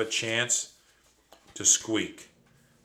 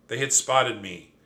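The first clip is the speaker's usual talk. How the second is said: ordinary delivery, nothing marked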